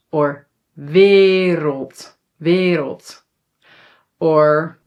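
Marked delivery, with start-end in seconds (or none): 0.8-3.2 WERELD with first vowel nearer ih before R; E more like I. Shown as a demonstration. Teacher shows E before R, shaded toward I. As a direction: model WERELD carefully as veer-ult. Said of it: "a little bit more like I".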